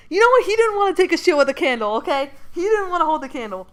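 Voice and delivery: defensive outburst. Real, not acted. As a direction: snap back at imagined critics, loud and high. Defending the toilet joke to chat.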